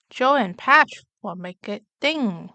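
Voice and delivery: ordinary delivery, nothing marked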